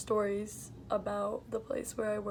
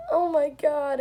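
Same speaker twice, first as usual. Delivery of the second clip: mock exasperated old lady, higher and louder; 5 semitones higher, 8 dB louder, slower than usual. Old lady fed up with the waitress, exasperated. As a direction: throw it out in irritation. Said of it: "And she's like".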